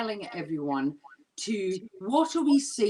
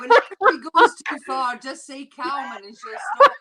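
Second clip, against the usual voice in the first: words bubbling with laughter, a bit louder; laughing while talking; 4 semitones higher, 10 dB louder than usual. Real, not acted. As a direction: explain it through real giggles. Explains code word while laughing.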